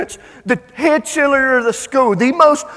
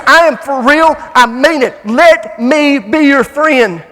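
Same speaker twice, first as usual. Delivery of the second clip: loud, raised acted plea, slowing at the end; emphatic plea; 3 semitones higher, 8 dB louder, slower than usual. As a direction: insist with full force, stretching the final words. Boy pleads earnestly for her friendship.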